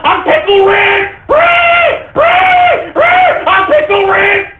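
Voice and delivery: mocking a fan's frantic shrieks; shrieking Pickle Rick. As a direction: scream it like an unhinged fan on a counter. Mocking costumed fans.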